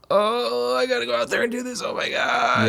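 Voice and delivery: self-mocking reluctant groan; mock dread. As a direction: whine it like you dread going on stage. Acting dread before a poorly attended show.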